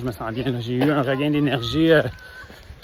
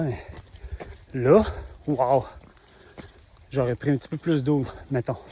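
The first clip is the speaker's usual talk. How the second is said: ordinary delivery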